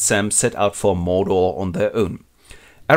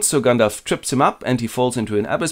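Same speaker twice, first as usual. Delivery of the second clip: ordinary talk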